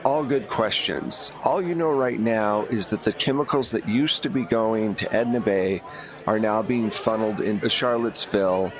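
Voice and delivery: usual speaking voice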